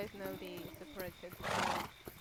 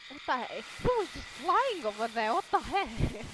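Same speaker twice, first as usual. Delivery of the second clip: loud, higher-pitched disbelief; startled outcry; 4 semitones higher, 9 dB louder than usual. Real, not acted. Player seeing someone flying over there, startled outcry. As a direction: exclaim loudly in real surprise.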